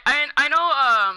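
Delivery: normal talking voice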